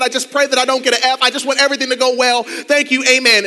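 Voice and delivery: loud, pleading prayer; fervent prayer. Retold or imitated. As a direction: beg God loud and fast. Desperate prayer in the bathroom stall.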